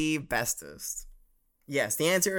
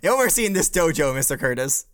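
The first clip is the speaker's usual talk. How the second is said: drill sergeant; 2 semitones higher, 8 dB louder, faster than usual. Pretend. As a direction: bark it like a drill instructor. Teacher barks at student in dojo.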